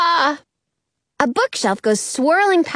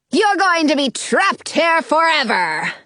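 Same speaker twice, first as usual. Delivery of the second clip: evil fairy voice; 3 semitones higher than usual. Acted. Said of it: "the evil fairy says".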